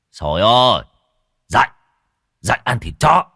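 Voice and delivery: loud, urgent wake-up call. A narrator's reading. Old Tân tries to wake the unconscious man, calling loudly. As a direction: call out sharply to rouse him.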